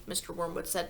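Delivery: ordinary delivery, nothing marked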